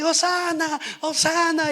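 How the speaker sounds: high, loud cries of praise; joyful shout. Retold or imitated. Donkey owner in the crowd cheering Jesus, high loud shout. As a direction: shout it up to the heavens.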